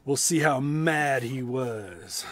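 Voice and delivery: ordinary delivery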